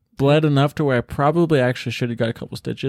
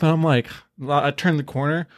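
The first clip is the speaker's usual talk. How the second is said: normal talking voice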